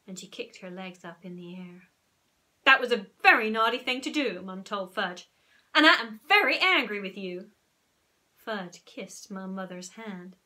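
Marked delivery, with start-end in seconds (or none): 2.6-7.5 louder, stern telling-off; a firm mother scolding her son. Acted. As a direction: let the boy know she means it.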